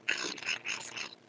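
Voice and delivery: whispered aside; breathy secret whisper. Real, not acted. Sudden unvoiced aside.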